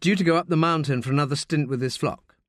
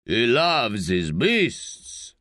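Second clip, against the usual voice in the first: scoffing; much slower than usual. Acted. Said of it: "scoffs Frog".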